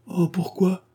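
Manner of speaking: high whining Oh, pourquoi; whimpering. A narrator's reading. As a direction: whine it like a sulky child. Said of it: "pleurnicha Odette".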